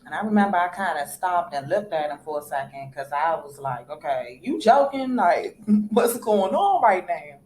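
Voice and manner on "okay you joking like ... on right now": high-pitched, incredulous questioning; retold disbelief. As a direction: replay being caught off guard, pitch jumping up. Retold reaction to sudden proposal.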